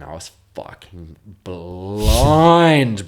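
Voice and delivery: slow, loud, stretched-out I was fucking blind; drawn-out blind. Real, not acted. Telling a mate about the bus, stretched loud emphasis. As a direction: lean hard and slow into blind.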